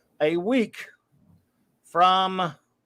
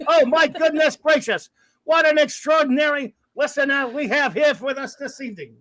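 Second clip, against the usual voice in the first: loud, raised sportscaster impression; Howard Cosell; 7 semitones higher, 3 dB louder than usual. Host jokes about doing every shout out his way, Cosell impression. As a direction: announce it in a grand, mock broadcaster voice. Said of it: "do it like Howard Cosell".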